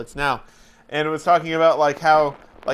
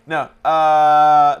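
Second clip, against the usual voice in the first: normal talking voice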